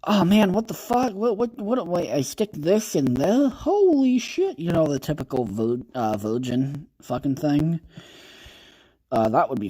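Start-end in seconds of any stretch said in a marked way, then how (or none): 0.0-4.6 put-on high, squeaky panicked boy; mock flustered Arthur. Streamer acts out Arthur fumbling, high and panicky. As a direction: ham up a clueless kid in a panic. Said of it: "being like".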